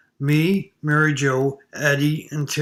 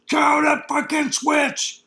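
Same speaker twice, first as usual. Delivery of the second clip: high, loud, slow furious yell out a window; father's yell; 11 semitones higher, 6 dB louder, slower than usual. Retold or imitated. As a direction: bellow it like an angry father across the tracks. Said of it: "I hear my old man yelling out the window".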